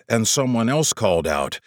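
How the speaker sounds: ordinary delivery, nothing marked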